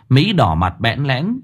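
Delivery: usual speaking voice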